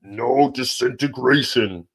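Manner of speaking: Darth Vader line. Retold or imitated. Quoting Vader's order to Boba Fett, slow and heavy. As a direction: intone it like Vader to a bounty hunter. Star Wars quote, Vader voice.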